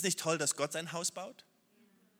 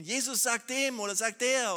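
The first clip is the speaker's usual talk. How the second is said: higher, louder mock whining prayer; whiny prayer; 6 semitones higher, 7 dB louder than usual. Preacher mocking how we whine at Jesus in prayer. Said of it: "dass wir manchmal so beten".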